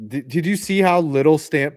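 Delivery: ordinary delivery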